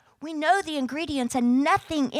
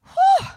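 high, loud whistling whew; 8 dB louder than usual. Real, not acted. Preacher reacting to the prophets' slaughter with a whew. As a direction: blow out a big relieved whew.

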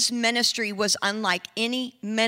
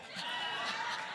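high burst of laughter; 15 dB quieter than usual. Real, not acted. Laughing at his own story.

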